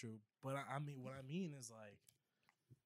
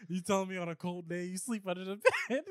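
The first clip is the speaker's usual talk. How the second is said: louder, higher, laughing through the question; 8 semitones higher, 12 dB louder than usual. Real, not acted. Friend teasing about sleeping under the bed, laughing. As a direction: tease him while cracking up.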